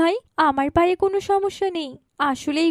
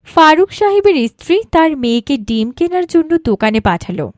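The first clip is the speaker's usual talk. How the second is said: louder, steady storyteller's voice; story narration; 7 dB louder than usual. A narrator's reading. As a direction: tell the tale to the audience. (গল্প বলার ভঙ্গিতে বর্ণনা)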